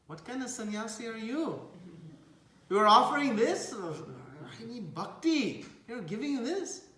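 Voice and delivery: high, incredulous questioning; indignant challenge. Retold or imitated. Chaitanya questions a sannyasi's odd blessing, indignant. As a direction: challenge him with rising indignation.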